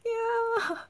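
high, gleeful little yeah; a child bursting out the door, gleeful; excited child. Pretend. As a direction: cheer like a freed kid.

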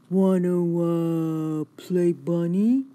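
dopey hesitant offer to play; big dog voice. A narrator's reading. Maurice invites Rosie to play, slow and halting. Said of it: "Maurice says".

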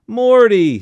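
loud high call; crying out. A narrator's reading. Aunt crying out at the father. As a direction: cry out his name. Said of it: "Sadie cried".

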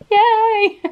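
a high, loud burst of fake glee; mock cheer. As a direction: cheer it out, half joking. Mock cheer about money woes.

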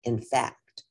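lively storybook reading; reading to children. A narrator's reading. Teacher reading a picture book to the class, lively. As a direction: read it out to the kids, bright and clear.

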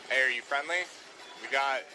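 ordinary talk

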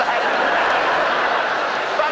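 big amused laugh; hearty laugh. Real, not acted. Laughing at teddy bear craze.

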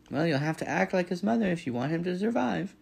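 high, insistent goose voice; advising goose. A narrator's reading. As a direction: instruct firmly as an older goose.

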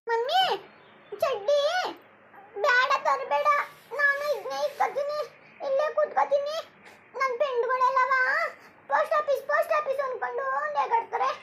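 put-on a small child begging mum not to cry; little child voice. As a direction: innocent child's voice. Child figure played by adult.

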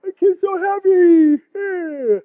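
high, slow dopey camel voice; puzzled delight, drawn out; happy camel. Retold or imitated. Voicing a camel in the photo, high and slow. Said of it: "It's like".

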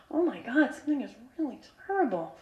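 retold soft, appalled aside; hushed dismay. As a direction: say it under your breath, queasy. Sudden horrible smell in car.

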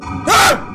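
short loud scream of fright; startled scream. Real, not acted. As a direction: yelp at a sudden scare. Jump scare at the end.

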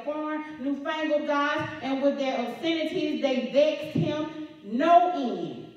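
slow, loud, hammered-out preaching; preacher pounding out the verse slowly. Acted. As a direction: drive each word home to the congregation.

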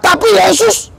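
high, ringing preacher call; preaching at full force. Acted. Preacher rousing the congregation to praise, ringing and high. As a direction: lift the room with it.

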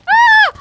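sudden high shriek; startled scream. Real, not acted. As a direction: yelp as you catch fire. Catching fire in game.

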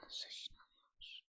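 slow unvoiced whisper. Real, not acted. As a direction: hush the question in awe. Whispered repeat of question. (fısıldayarak)

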